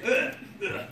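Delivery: "uh" as an exaggerated French "heure" vowel; imitating French heure. Shown as a demonstration. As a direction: demonstrate the French sound on "uh". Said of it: "You see, it was like that".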